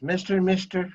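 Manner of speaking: put-on high, eager little boy calling for attention; lightly voiced young boy tugging for attention; kid calling out. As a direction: call up to the car like a ten-year-old. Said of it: "He says".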